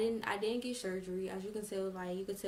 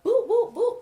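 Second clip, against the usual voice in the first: high, loud, punchy boop sounds; three explosive cartoon-like hit noises; imitated punches; 13 semitones higher, 14 dB louder than usual. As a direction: mimic blows with sharp high boops.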